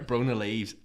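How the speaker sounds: ordinary delivery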